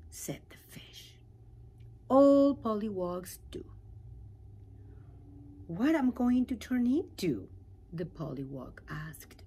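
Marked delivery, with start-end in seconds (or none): 2.1-3.6 louder knowing fish; lightly voiced confident fish. Fish reassures pollywog. 5.7-7.5 higher, louder curious voice; curious little pollywog; eager pollywog. Acted. Pollywog asks.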